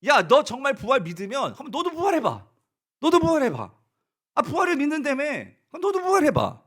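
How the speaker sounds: taunt, rising in pitch and volume; jeering taunt. Retold or imitated. Mockers challenging believers, loud jeering dare. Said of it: "조롱을 합니다".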